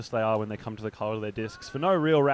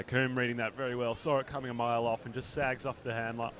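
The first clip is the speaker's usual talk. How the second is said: ordinary talk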